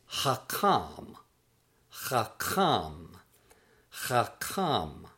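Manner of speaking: "hakam" as hah-KAHM, drawn out; pronunciation demo. Shown as a demonstration. Teacher models the word for wise, careful pronunciation of "hakam" as hah-KAHM. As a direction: say "hakam" slowly, landing on "kahm". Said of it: "that is pronounced in Hebrew as".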